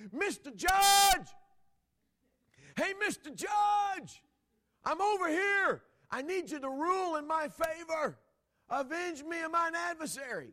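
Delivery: very high, slow, shouted calls; hollering widow. Retold or imitated. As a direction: yell across the square to the judge. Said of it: "she hollered".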